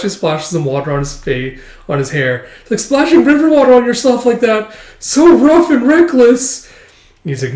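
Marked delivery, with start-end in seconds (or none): 2.8-6.7 hammed-up lovestruck gush; swooning girl. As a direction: gush over him breathlessly.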